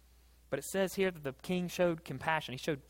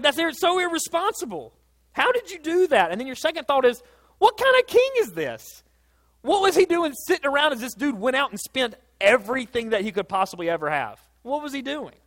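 outraged; 10 semitones higher, 8 dB louder than usual. Acted. Voicing indignant listener.

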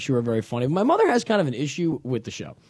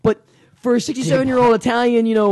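usual speaking voice

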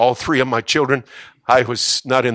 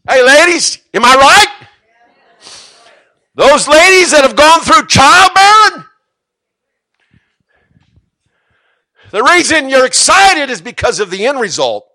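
much higher, louder, rousing call to the women; 14 dB louder than usual. Real, not acted. Preacher calling out to the ladies who gave birth. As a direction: fire up the mothers in the room.

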